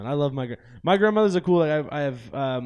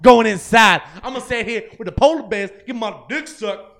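shouting; 7 semitones higher, 11 dB louder than usual. Pretend. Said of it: "they're all like".

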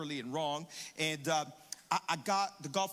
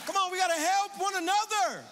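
high, loud rallying cry; preaching at full force; 11 semitones higher, 6 dB louder than usual. Acted. Pastor urging the church to tell their neighbor, loud rallying preaching. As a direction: rouse the congregation, full voice.

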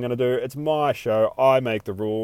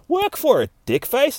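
mocking retort; 13 semitones higher than usual. Real, not acted. Speaker retorts to the redneck.

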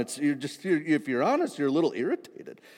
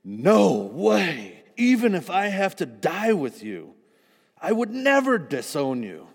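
loud, higher, insisting hard; emphatic insistence; 6 semitones higher, 6 dB louder, slower than usual. A narrator's reading. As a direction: swear it with all your heart. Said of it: "Peter insisted emphatically".

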